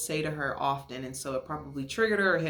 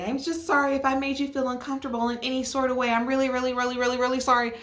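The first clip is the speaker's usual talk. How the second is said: higher, fast, overdone sorry; mock tearful apology; 6 semitones higher, faster than usual. Girls gushing apologies to the new girls, higher and fast. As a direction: gush the apology like a sorry contestant.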